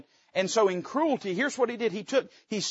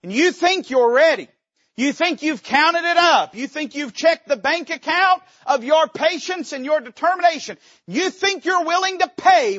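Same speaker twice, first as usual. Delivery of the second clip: higher and louder, pressing hard; emphatic challenge; 8 semitones higher, 4 dB louder than usual. Acted. Preacher confronts the tempted congregation, loud and high. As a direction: push each "you think" up and out.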